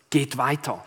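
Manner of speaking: ordinary delivery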